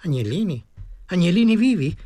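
lightly voiced woman querying in surprise; puzzled old woman. Old woman questioning the offer of lambs, puzzled.

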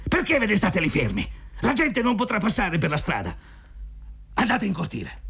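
rimprovero stizzito e molto acuto; grido con ira. A narrator's reading. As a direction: caccia via qualcuno gridando in alto, senza forzare il volume. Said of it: "gridò con ira". (sgridata irata e acuta)

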